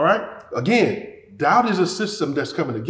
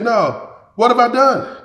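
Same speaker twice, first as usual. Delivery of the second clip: higher, louder defensive reply; protesting David; 6 semitones higher, 5 dB louder, slower than usual. A narrator's reading. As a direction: protest innocence to an older brother. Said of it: "David replied".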